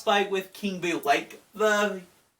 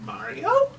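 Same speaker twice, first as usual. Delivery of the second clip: lightly voiced puzzled cartoon hero; slightly raised, questioning call to a brother; Luigi; 5 semitones higher than usual. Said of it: "Luigi's like".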